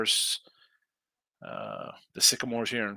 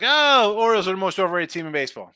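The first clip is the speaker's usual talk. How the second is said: mocking Twitter critic; 6 semitones higher, 6 dB louder than usual. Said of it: "comment on Twitter like".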